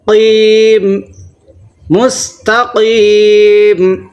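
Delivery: slow recited مستقیم; heavy qaf. Shown as a demonstration. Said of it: "بڑا قاف ہے".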